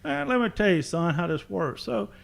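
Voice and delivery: a hint of a patient old salt; gruff old fisherman. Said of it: "this old sailor stops and says".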